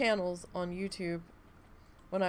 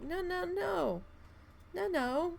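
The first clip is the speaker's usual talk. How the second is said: much higher whiny "no, no"; exasperated protest; 10 semitones higher, slower than usual. Retold or imitated. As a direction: plead against the runny nose, pitched way up. Groaning at her runny nose.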